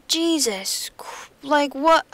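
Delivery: breathless, whispered aside; shocked whisper. Real, not acted. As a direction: gasp it under your breath. Shocked by the dead husband.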